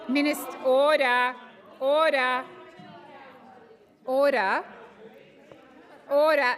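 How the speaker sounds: parliament speaker. Real, not acted. President calls noisy chamber to order.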